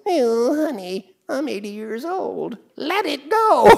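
put-on old dad on his cane answering his wife; tired old dad. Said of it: "he goes".

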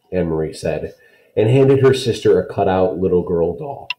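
usual speaking voice

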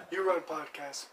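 ordinary talk

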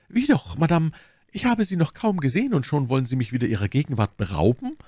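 high, courteous lady's protest, easing down; protesting Milady. A narrator's reading. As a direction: protest sweetly to keep her from leaving.

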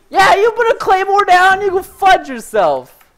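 slow, high, loud rant; angry yell. Real, not acted. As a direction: yell slowly at the enemy, outraged. Rages at enemy's claymore.

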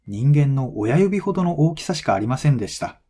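ordinary talk

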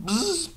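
high, soft buzzing noise; imitated phone buzz. Real, not acted. Vlogger noticing the phone buzzing, high soft imitated buzz. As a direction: buzz softly like a vibrating phone. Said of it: "My phone is buzzing on me".

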